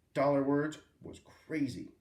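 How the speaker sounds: ordinary delivery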